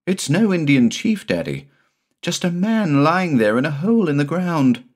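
put-on high, earnest child's voice; insistent little boy. A narrator's reading. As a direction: insist it's real, wide-eyed.

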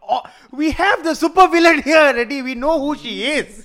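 high, loud outraged rant; excited rant. Real, not acted. Mock outrage.

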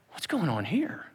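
ordinary talk, nothing marked

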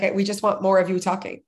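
ordinary talk

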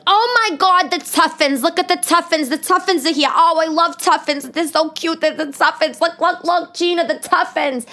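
high, gushing New York woman; thick New York accent. Retold or imitated. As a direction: excitedly imitate a raging New Yorker with a thick accent. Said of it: "she had a thick New York accent".

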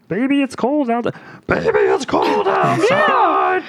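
puppet duet voice. Acted. Co-host imagining Muppets' Christmas duet, puppet singing voice. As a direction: belt it high like a singing puppet. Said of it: "It sounds like".

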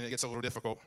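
ordinary delivery

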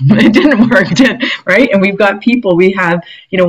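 laughing through it, pitch up. Real, not acted. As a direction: chuckle the words out. Repeats retort laughing.

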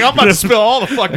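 high, loud, rushed boast; hyped-up confession. Retold or imitated. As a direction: blurt it out fast and high, half laughing. Acted gleeful announcement, laughing teller.

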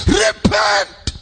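repeated repent shouted high and loud; preaching shout. Real, not acted. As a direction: cry it out to the nation. Preacher calling nation to repent.

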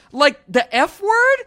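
put-on high, slow, innocent kid voice; kid voice punchline. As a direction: play the naive boy checking the rules. Norman's punchline in joke retold.

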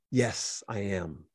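low, soft, calm answer; hushed, low-pitched confirmation; quiet admission. Acted. Jesus answers the questioner.

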